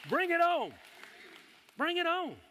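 high, loud rallying cry; rousing shout. Shown as a demonstration. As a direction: shout it out to fire up the congregation. Models what congregation should shout.

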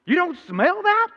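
high, slow mock disbelief from the stranger; slow high-pitched quote of an amazed stranger; incredulous stranger. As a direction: play the baffled stranger who can't believe it. Stranger repeats question in disbelief.

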